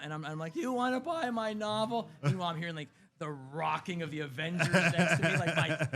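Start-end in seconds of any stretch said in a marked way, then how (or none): 0.6-2.1 hawking my novel. Retold or imitated. Pitching his book at con.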